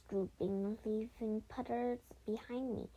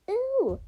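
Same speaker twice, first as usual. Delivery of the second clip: high, loud startled squeal; loud high-pitched yelp; yelled "ooh"; 11 semitones higher, 10 dB louder than usual. A narrator's reading. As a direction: shriek a piercing "ooh" in mock fright. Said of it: "she yelled".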